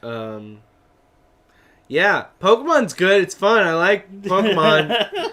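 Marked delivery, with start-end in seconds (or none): 1.9-5.3 excited. Real, not acted. Gushing wrap-up about Pokemon.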